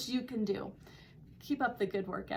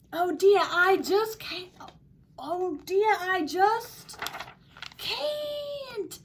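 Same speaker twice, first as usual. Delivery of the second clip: high, loud storybook panic; hammed-up frightened cry; panicked wail; 8 semitones higher, 5 dB louder, much slower than usual. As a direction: wail it, climbing to a drawn-out cry. Kevin panics, clinging to the tree.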